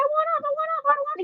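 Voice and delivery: very high soft desperate begging; tiny terrified whine to get off; whimpering plea. Retold or imitated. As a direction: plead like a scared child. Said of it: "screaming and panicking".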